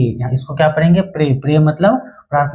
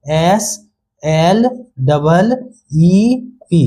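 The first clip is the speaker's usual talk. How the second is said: "एस, एल, डबल, ई, पी" letter by letter with pauses; spelled out slowly; much slower than usual. Shown as a demonstration. Teacher breaking down the word for children, spelled out slowly. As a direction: slowly separate each part of "एस एल डबल ई पी". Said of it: "अब कैसे हुआ".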